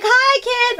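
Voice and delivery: high sing-out mock greeting; doting parents. Parents greeting their spoiled kid, high mock-doting voice. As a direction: imitate parents cooing at their child.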